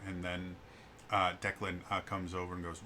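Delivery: normal talking voice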